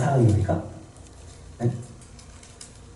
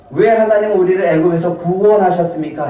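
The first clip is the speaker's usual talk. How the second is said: higher, pointed mock grievance; resentful complaint; 6 semitones higher, 4 dB louder than usual. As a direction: accuse God of the rescue. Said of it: "원망은요".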